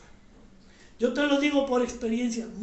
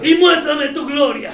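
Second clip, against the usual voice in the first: much louder, calling out each line; shouted prayer lead; 10 dB louder than usual. Real, not acted. Pastor leads the standing congregation line by line, shouting. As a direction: shout the prayer for the church to echo.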